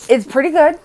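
high, loud excited announcement. Real, not acted. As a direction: burst out with the pick. Sudden excited decision.

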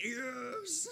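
high, drawn-out cry of glee; gleeful drawn-out squeal; triumphant yes. Acted. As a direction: exult in it at a high pitch. Acting out triumph at counterspelling.

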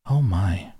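mildly startled exclamation; gasped. A narrator's reading. Woman in the office seeing the girl, gasped. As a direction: react with a small gasp of surprise. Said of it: "I heard a female voice gasp".

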